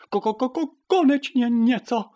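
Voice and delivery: high, loud clucking cheer; stuttering hen cluck bursting into joy; jubilant. A narrator's reading. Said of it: "jásala Pipina".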